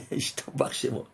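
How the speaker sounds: whispered through a laugh; amused whispered aside. Real, not acted. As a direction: chuckle it under your breath. Delighted aside after gematria reveal.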